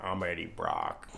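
slow, low, slurred mumble; blackout drunk. Retold or imitated. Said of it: "blackout drunk in the trailer".

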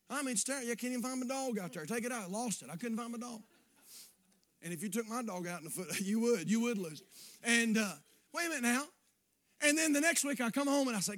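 ordinary delivery

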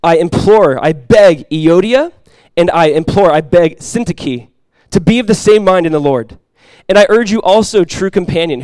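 loud, emphatic Bible reading from the pulpit; scripture reading. Acted. Pastor reading an epistle aloud to the church, emphatic scripture reading.

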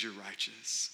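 soft, quiet, kind pardon; gentle release. Retold or imitated. Judge freeing the guilty defendant, quiet and kind. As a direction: let them go softly, almost hushed. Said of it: "he says".

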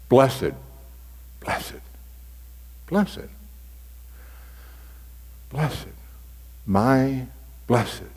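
soft repeated blessed with long pauses; lingering on one word. Shown as a demonstration. Pastor dwelling on one scripture word in prayer. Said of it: "You stick with a single word sometimes".

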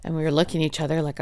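normal talking voice

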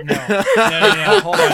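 high, loud laughing no; laughing protest. Real, not acted.

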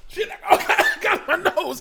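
high laughing cry. Real, not acted. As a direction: laugh through the burn. Chips too hot, laughing.

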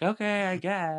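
high, airy put-on compliance; mock chill compliance. Host mimics Sandoval going with the cops, mock easygoing. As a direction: imitate someone going along without protest. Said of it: "was so chill about it".

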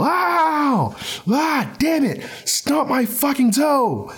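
high mock yell of pain, slowing at the end; angry yell. Imitating Sean yelling over his stubbed toe, high mock yell. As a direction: yelp like you just stubbed your toe. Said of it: "he will angrily yell".

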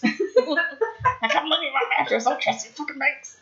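high, sneering money hoarder; paranoid miser voice. Retold or imitated. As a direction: play a cranky cash hoarder. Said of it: "Mister Money in the mattress".

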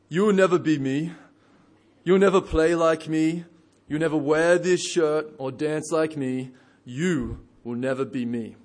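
a defiant athlete boasting to camera; mimicking Paul Pogba. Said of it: "mimic his voice".

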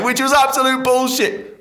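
high, loud exclaim; exaggerated disbelief; squealing high exclamation; incredulous outburst. Real, not acted. Laughing at own lie.

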